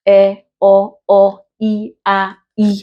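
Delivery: E, O, O, I, A, I as isolated vowels; vowel sounds alone. Shown as a demonstration. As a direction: sound out E, O, O, I, A, I one by one. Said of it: "Vowel sounds alone".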